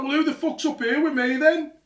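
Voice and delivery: very high, loud, indignant demand; imitated alarmed shout. Dad alone upstairs to son, incredulous yell. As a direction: re-enact dad yelling down in disbelief.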